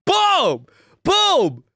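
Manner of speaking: high loud triumphant Boom!; excited shout. Real, not acted. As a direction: punch it out in victory. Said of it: "celebrating the comeback".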